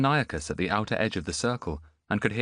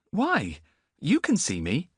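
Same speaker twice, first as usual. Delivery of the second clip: put-on young boy; 9 semitones higher, slower than usual. Boy to Antiochus, high bewildered voice. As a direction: sound lost and bewildered.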